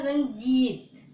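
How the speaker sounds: रंजीत read out clearly for the class; teacher sounding out a word; spelling-out read. Shown as a demonstration. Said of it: "क्या लिखा है".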